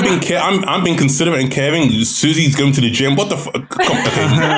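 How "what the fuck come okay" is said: laughing disbelief. Real, not acted.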